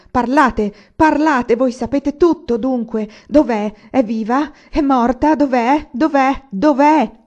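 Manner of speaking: loud, high, insistent volley of questions; frantic, irritated shouting. A narrator's reading. Anania demands to know where she is, frantic. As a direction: hammer out each question, half crazed. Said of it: "Pareva un po' impazzito, ma più irritato che commosso".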